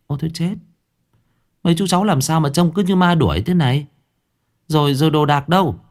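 high, startled questions; a wife shocked at their state; astonished woman voice; surprised wife. A narrator's reading. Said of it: "Cô Yến ngạc nhiên".

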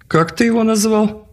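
shrieking high shout. Acted. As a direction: yell it in outrage. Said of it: "закричал Эльрах".

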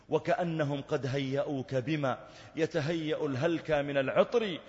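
ordinary talk, nothing marked

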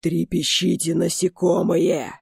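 slow, menacing undead lich voice; a lich sneering at insects; inhuman voice. Acted. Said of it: "продолжил голос".

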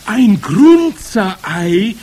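high, loud, drawn-out screech; shriek. A narrator's reading. Witches astonished by the ingredient, shrieking. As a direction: shriek the question, stretching it out. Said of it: "kreischten die Hexen".

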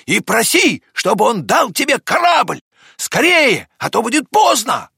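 high, loud, pressing instructions; Авва shouting. A narrator's reading. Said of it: "закричала доктору Авва". (настойчиво кричит высоким голосом)

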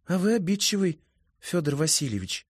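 put-on high, chatty girl voice; a lively young woman; high female voice. A narrator's reading.

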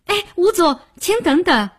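calling after someone. A narrator's reading. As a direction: call out boldly after a man walking off. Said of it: "大胆地在身后叫住他".

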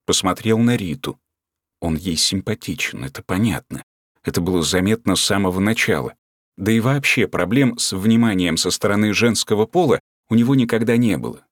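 usual speaking voice

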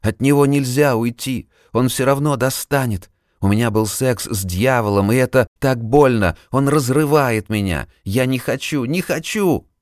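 high, panicked pleading; terrified. Acted. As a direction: panic rising to a high cry. Terrified patient recalling the devil.

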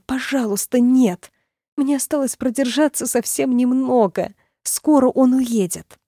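high pleading, slowing at the end; desperate plea. Acted. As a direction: beg yourself to hold on. Heroine's desperate inner plea.

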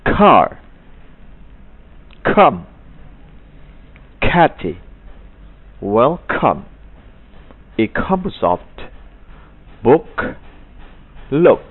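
repeat-after-me k drill. Shown as a demonstration. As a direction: say each word for the class to follow. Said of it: "Sekarang ikuti".

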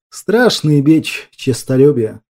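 ordinary delivery

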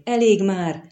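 moderately loud echoed cry. A narrator's reading. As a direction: call out for it to stop. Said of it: "visszhangozta összekuporodva Kahir".

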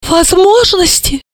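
high, loud outrage; choked with indignation. A narrator's reading. Mother railing about her son-in-law, outraged. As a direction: splutter indignantly at high pitch. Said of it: "задохнулась от возмущения".

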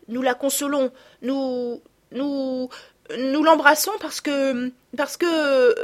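put-on a blushing girl who can't find the words; embarrassed stammer. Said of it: "Elle rougit et se tut".

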